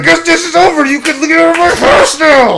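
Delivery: high, loud hammed-up dismissal; mock dramatic breakup. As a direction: play a jilted partner throwing him out.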